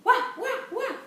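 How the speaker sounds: high, loud mock woofs; three playful yaps; imitated dog bark. Dog answers in story.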